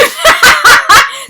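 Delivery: high, loud cackling burst; loud laugh. Real, not acted. Vlogger retelling her stepmother's line, loud laugh.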